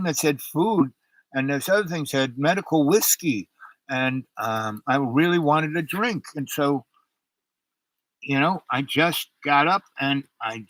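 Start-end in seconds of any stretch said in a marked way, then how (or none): none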